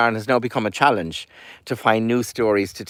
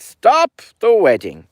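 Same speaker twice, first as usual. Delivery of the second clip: louder and higher, slow; shouted command; 8 semitones higher, 6 dB louder, slower than usual. Acted. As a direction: burst in and halt the wedding. Goatherd bursts into wedding.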